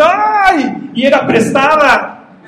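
high, loud wail of dismay, slow at the end; dismayed cry. Acted. Prophet wailing to Eliseo about the borrowed axe head.

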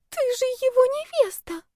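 put-on very high, timid girl's voice; small squeaky voice, mumbling shyly; timid mumble. A narrator's reading. Lovesick girl to the supposed fiancée, timid high mumble. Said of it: "промямлила она".

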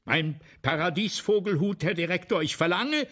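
loud, high insistent demand to the director; demanding shout. Retold or imitated. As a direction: call out the demand with full force. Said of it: "Und ich gehe zum Direktor und rufe".

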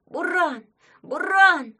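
high, loud desperate call; shouting. A narrator's reading. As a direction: call the horse with all you have. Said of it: "Ваня громко крикнул".

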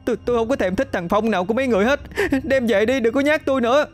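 put-on high, fast, tearful girl's protest; blubbering cry. A narrator's reading. Hân crying at the ghost outside her door, tearful. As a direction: wail it out like a sobbing child. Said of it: "Hân òa khóc".

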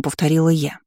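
ordinary delivery, nothing marked

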